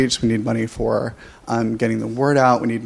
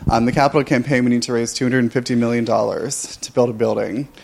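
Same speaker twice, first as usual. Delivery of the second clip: ordinary talk, nothing marked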